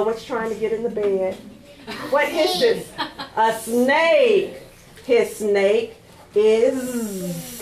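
plain reading voice

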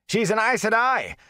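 angry start cut short. A narrator's reading. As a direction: start hotly in protest. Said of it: "Hari began angrily".

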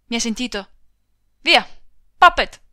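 urlo acuto e molto forte; grido d'ordine. Acted. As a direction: caccia via il cavallo con un grido. Marito scaccia la moglie. (il marito urla alla moglie di partire, gridando)

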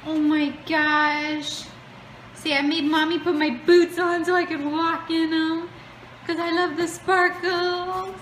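put-on high playful little girl. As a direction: speak for the toddler, bright and high. Voicing the child.

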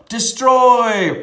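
big booming shout of the word "Destroy"; playful booming "Destroy"; dramatic shout. Pretend.

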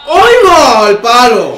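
high loud cry at a near miss; shocked shout. Real, not acted. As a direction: yell in disbelief. Shot hits the post.

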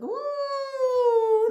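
ordinary talk, nothing marked

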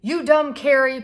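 put-on high, loud angry boy's retort; yelling back. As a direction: shout it right back at her. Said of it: "P J yelled back".

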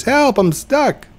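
high, mock panicked help call; friend's cry for help. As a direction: squeal it like a stuck buddy. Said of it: "and now he says".

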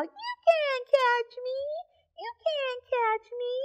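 squeaky sing-song taunt; teasing monkey voice. Acted.

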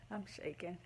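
a hushed laugh into the words; soft laugh. Real, not acted. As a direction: laugh it out under your breath. Laughing in joyful disbelief.

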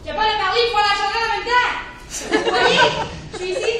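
loud, insistent reprimand; scolding. Acted. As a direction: call out over someone who is talking. Scolds someone talking during interview.

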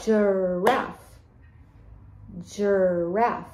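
Giraffe stretched into two separate beats; Giraffe, drawn out as ji-raffe; syllable by syllable. Shown as a demonstration. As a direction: hold the first syllable of Giraffe, then land the second. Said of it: "Let's say giraffe together and count the syllables".

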